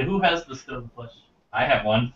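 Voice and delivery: ordinary talk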